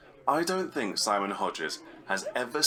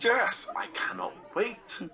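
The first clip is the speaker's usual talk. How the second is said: slow, much higher gushing delight; excited; 11 semitones higher, slower than usual. Acted. Friend thrilled about the after-premiere breakfast, slow high gush. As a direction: savour each word with glee.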